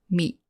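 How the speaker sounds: "meet" with the t cut off before release; unreleased final t. Shown as a demonstration. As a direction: start the "t" in "meet" and stop. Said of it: "you almost start to say the t, but you stop".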